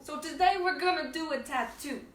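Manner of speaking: hammed-up Brooklyn twang; Brooklyn accent. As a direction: imitate a Brooklyn tattoo artist. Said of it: "I was having a Brooklyn accent".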